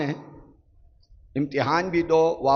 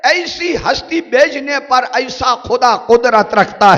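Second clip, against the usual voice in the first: high, loud sermon peak; fiery preaching; 10 semitones higher, 8 dB louder than usual. Acted. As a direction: thunder it out to the crowd. Preaching at full force.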